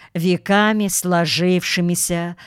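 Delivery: ordinary delivery, nothing marked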